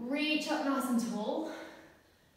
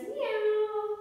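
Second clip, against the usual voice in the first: high playful meow, a bit louder; cat meow; 13 semitones higher, 5 dB louder than usual. Pretend. Teacher meowing like a cat for the kids in cat pose. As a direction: meow like a cheeky kitten.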